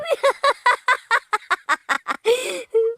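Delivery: high-pitched giggling burst; high laugh. Real, not acted. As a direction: laugh out high after the joke. Leader laughs at joke.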